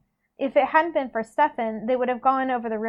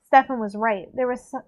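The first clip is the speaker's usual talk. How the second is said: plain reading voice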